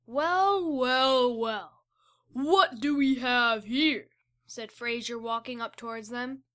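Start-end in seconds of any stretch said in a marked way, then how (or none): 0.1-4.1 slow, sly menacing drawl; villain voice. Acted. Fraser the Krillis gloating at the trapped kids, slow. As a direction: savour each word like a gloating villain.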